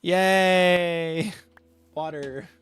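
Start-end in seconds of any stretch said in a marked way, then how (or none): 0.0-1.3 cheer. Real, not acted. Mock cheer after laughing.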